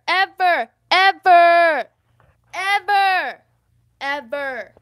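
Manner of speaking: NEVER, EVER hammered over and over, building to a loud shout; emphatic repetition. Real, not acted. Vlogger stressing the biggest lesson learned, EVER repeated with mounting emphatic force. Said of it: "I gotta emphasize it".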